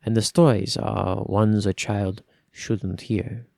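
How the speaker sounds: slow, grave first-person storytelling; brooding story narration. A narrator's reading. Reader voicing a cursed heir, grave and slow. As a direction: let the dark confession unfold slowly.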